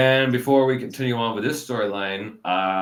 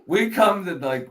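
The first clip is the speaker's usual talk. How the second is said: ordinary talk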